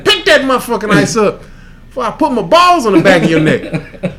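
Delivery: loud, hammed-up cussing tirade; mock tirade. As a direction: yell it at the kids, laughing through it. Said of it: "You ain't go".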